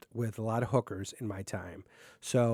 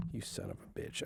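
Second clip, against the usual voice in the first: whispered curse; 14 dB quieter than usual. Real, not acted. Host curses at the mic stand, whispered. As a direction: curse it under your breath, unvoiced.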